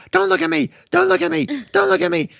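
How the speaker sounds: very high, pleading shriek; high, panicked cry at the watching crowd; frantic shrieking. Retold or imitated. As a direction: shriek it as if cornered. Villain shrieks at followers.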